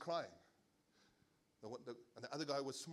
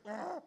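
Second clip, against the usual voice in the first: put-on startled child yelp; 11 semitones higher, 4 dB louder than usual.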